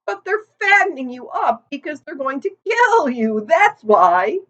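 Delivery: put-on a gossipy old sheep leaning in. A narrator's reading.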